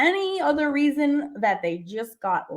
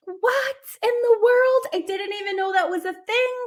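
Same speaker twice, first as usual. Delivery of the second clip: amazed; 7 semitones higher than usual. Real, not acted. Amazed at victory rolls.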